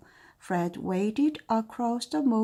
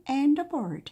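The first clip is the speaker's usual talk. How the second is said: ordinary delivery, nothing marked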